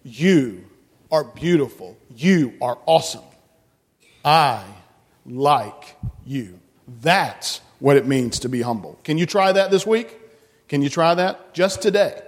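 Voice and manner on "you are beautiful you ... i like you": low, measured words with pauses; sincere compliment. Shown as a demonstration. Telling children what to say to somebody else, sincere and low. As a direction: give each word room, meant for the other person. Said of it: "look at somebody else and say".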